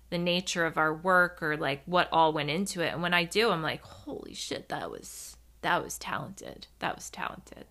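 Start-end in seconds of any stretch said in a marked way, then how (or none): 3.8-7.7 very soft, half-whispered amazement; hushed awe. Retold or imitated. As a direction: marvel under your breath. Retells awed reaction to own work.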